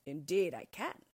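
proud declaration in a changed voice; proud ogre. A narrator's reading. Flattered ogre answers the cat, proud boast. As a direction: say it with storybook pride. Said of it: "said the org proudly".

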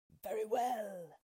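a hint of obedient wolves; wolves' voice. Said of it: "replied the wolves".

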